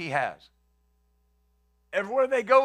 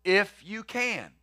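slow, doubtful "If you can", dwelling on the words; preacher mulls over the father's doubting words slowly; slow, doubtful quote; much slower than usual. Shown as a demonstration. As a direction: let the doubt hang in every word. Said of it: "Now, think about that".

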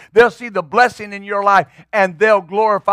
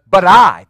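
"but I" punched hard and loud; emphatic BUT I; 10 dB louder than usual. Acted. Preacher shows what Jesus stressed, emphatic "but I". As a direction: hit "but I" with a forceful burst. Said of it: "it's emphatic".

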